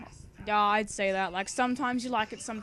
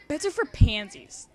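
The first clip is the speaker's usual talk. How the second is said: louder, cheeky dismissive line; 5 semitones higher, 9 dB louder than usual. Acted. Girl brushing off the question about her bed, cheekily. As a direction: toss it off with cheek.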